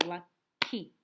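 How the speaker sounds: Lucky split as LUCK-Y, two loud claps; clapped syllables. Shown as a demonstration. Teacher to first graders, clapping Lucky's syllables. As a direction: clap out Lucky in two beats. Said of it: "Let's clap to Lucky".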